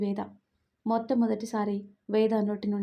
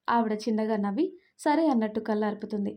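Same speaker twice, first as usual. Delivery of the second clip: ordinary delivery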